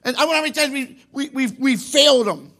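loud, high preaching build; fervent preaching. Real, not acted. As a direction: preach it out with full force. Preaching at full force.

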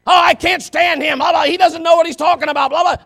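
mocking gossipers. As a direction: scoff at the pastor like the gossips did. Mimics gossipers attacking him.

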